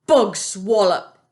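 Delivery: slow, loud sneering boom; booming scoff. A narrator's reading. Bloodbottler dismisses the BFG. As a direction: boom the insult. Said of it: "he boomed".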